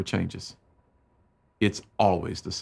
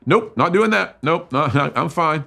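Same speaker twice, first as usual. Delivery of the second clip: loud, high, defensive snap; mock defiant shout; prideful refusal; 6 semitones higher, 5 dB louder than usual. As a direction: push her away with stubborn pride. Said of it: "Pride lunged in front of me".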